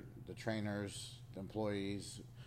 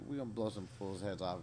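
usual speaking voice

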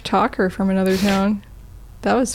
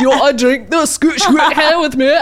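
louder, higher mock Scottish accent with a laugh; 4 semitones higher, 4 dB louder than usual. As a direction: toast a friend like a merry Scot. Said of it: "please do your Scottish accent".

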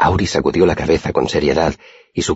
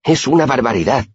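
high-pitched indignant outburst; heated; 10 semitones higher than usual. A narrator's reading. Simón, outraged at the whipping, heated high-pitched protest. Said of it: "dijo Simón muy acalorado".

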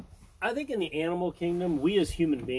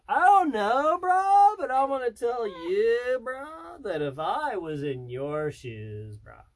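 high slow surfer dude drawl; frat bro; 5 semitones higher, slower than usual. Pretend. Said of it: "Hey, brah, look here, brah".

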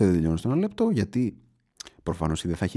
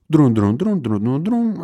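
louder, sung-out ringing tone; phone ring imitation; 5 semitones higher, 7 dB louder, much slower than usual. She waits on the courier's phone line, mimicking the louder ring.

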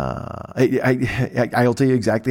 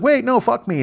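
pitched way up, pleading; 13 semitones higher than usual. Retold or imitated. Nefertiri begs Moses to stay, much higher and pleading. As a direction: cry out much higher, desperate.

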